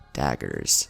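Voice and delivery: usual speaking voice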